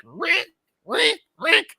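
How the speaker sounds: a hint of the slasher mom calling Rick; mock Mrs. Voorhees. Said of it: "And she's like".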